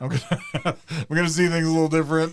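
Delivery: talking through giggles; laughing. Real, not acted. Joking to friends about alpacas, laughing. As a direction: laugh out the punchline. Laughing while telling joke.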